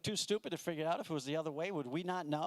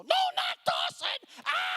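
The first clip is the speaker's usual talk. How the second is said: much higher, louder slow panicked shout; panicked scream; 7 dB louder, slower than usual. Pretend. Passenger freaking out the whole plane, panicked shout. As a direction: scream in terror at the pilot's name. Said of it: "freak the whole plane out and go".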